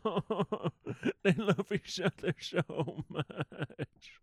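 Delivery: laughing, slow and swooning; swoony laughing gush; gushing through laughter. Retold or imitated. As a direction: gush while giggling. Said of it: "I was like".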